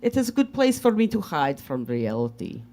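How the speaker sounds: put-on light Russian accent, broken English. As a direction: tell the story in a Russian accent. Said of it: "do a Russian accent".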